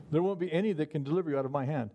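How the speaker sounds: ordinary talk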